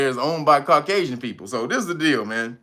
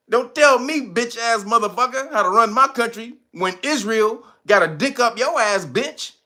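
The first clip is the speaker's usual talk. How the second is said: mock president retort; 7 semitones higher than usual. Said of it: "That's what he should have told me".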